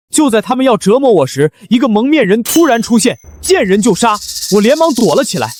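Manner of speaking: high-pitched, animated storytelling; dramatic tall tale. Acted. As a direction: sell the made-up rescue story. Husband makes up dramatic tale.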